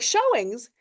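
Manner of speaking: can't believe what he just heard; retold high incredulous outburst; incredulous disbelief. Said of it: "I'm like".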